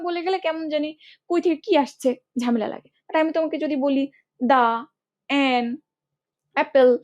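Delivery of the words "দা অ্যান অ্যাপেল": "দা, অ্যান, অ্যাপেল" one at a time, with pauses; short separate chunks. Shown as a demonstration. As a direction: leave a clear gap after "দা" and after "an". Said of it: "কত সুন্দর ছোট ছোট বানান". ("দা, অ্যান, অ্যাপেল" আলাদা আলাদা করে, থেমে থেমে)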